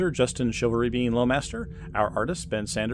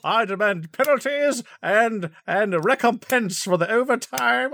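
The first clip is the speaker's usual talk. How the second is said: mock pompous demand; 11 semitones higher, 4 dB louder, slower than usual.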